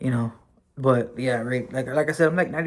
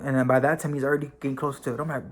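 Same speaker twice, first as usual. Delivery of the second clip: normal talking voice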